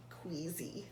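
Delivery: usual speaking voice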